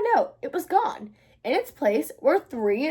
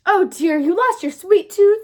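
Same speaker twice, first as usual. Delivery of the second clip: louder, slow, dismayed sympathy; a mom gasping over the lost tooth; slowed-down, louder "oh dear" of mock alarm; surprised mother; 5 semitones higher, 8 dB louder, slower than usual. Mother reacts to child's news.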